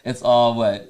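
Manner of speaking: real laughter while trying an accent; giggling at his own failed accent attempt; laughing through it. Real, not acted. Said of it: "I can't even do it".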